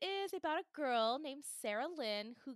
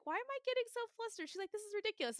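put-on flustered girl; 10 semitones higher, faster than usual.

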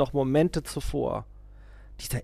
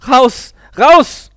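very high, very loud screech; a man screaming the guru out; shriek; 12 semitones higher, 19 dB louder than usual. A narrator's reading. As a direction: shriek it twice. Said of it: "kreischte ich".

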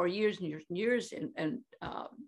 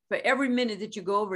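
rattled-off rule; 4 dB louder, much faster than usual. Retold or imitated. Retelling organisers' fee rule.

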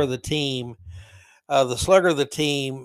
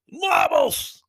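much higher, loud indignant yell; outraged shout; 12 semitones higher, 6 dB louder than usual. Retold or imitated. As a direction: explode at the insult. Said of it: "And the guy goes".

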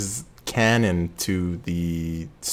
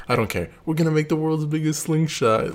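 gleeful villain; 8 semitones higher than usual. Retold or imitated. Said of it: "He's like".

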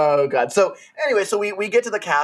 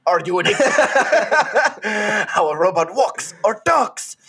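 put-on Slavic robot accent; 4 semitones higher than usual. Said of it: "sorry, that went Mexican real quick".

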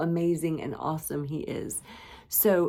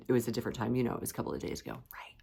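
ordinary talk

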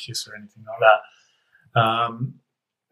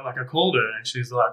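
ordinary delivery

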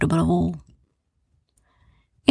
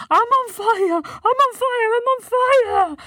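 high frantic yells; panicked shout. A narrator's reading. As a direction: shriek in panic. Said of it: "he shouted".

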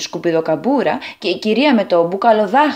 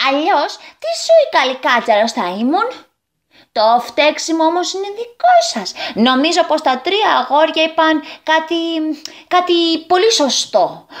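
goblin lecturing; 7 semitones higher than usual. A narrator's reading.

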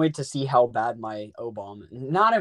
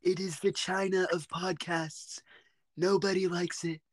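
put-on presidential impression; Donald Trump; 5 semitones higher, 4 dB quieter than usual. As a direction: declare it in a Trump-style voice. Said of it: "like, a Donald Trump voice".

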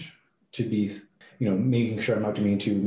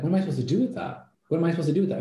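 exasperated; 7 semitones higher, much faster than usual. Retold or imitated. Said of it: "And I'm like".

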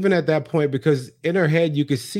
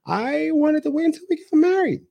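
mocking Jen; 3 dB louder than usual. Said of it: "did that weird smile thing".

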